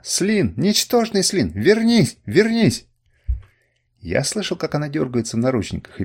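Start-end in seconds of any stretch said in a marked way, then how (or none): 0.0-2.9 high, loud insults building into a scream; furious shouting. A narrator's reading. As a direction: yell after him, louder each time. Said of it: "закричала она". (гневный крик, всё громче и выше)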